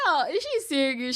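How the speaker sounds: shocked reaction to the weight-loss clip; incredulous. Real, not acted. Outraged disbelief at clip.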